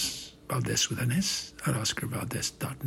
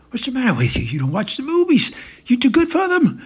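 loud, high-pitched exasperation; loud, needling outburst; agitated taunt; 10 semitones higher, 10 dB louder, faster than usual. Acted. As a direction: throw it at the silent man, climbing higher. Trump berates the silent man.

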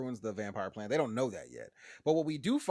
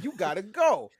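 higher and louder dismissal; loud mock Bev; 6 semitones higher, 8 dB louder than usual. Bev kicks him out, higher and louder. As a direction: throw him out loudly.

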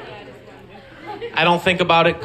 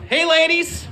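a lightly higher, breezy host greeting; mock smooth host; 8 semitones higher than usual. Greeting Janelle and Marie on the call, lightly higher breezy host. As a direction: play it cool like a slick emcee. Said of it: "So I go".

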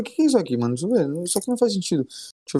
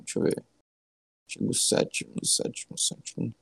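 muttering to himself; 6 semitones lower, 7 dB quieter than usual. Real, not acted. Muttering while searching the text.

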